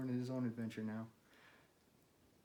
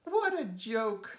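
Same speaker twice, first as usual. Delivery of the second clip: high, slow, sneering robin voice; mocking robins; 10 semitones higher, 6 dB louder, slower than usual. Robins jeering at Toad's failed kite, high sneer. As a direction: jeer like a smug little bird.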